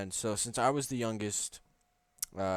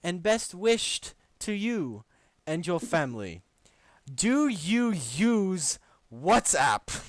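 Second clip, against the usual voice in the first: higher and slow, hammed-up; mock sweet reading; 7 semitones higher, 5 dB louder, slower than usual. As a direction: drag it out sweetly. Reads Lewis's message mockingly.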